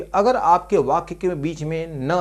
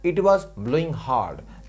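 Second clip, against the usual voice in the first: slow dictation; 3 semitones lower, much slower than usual. Shown as a demonstration. Teacher dictating an English example sentence.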